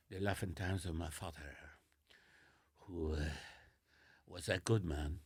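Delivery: low, soft, solemn French accent; halting mock-serious French eulogy, very quiet; real French accent. Shown as a demonstration. Honouring his late father in a would-be serious French accent, low and hushed. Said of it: "I'll do a real French accent now".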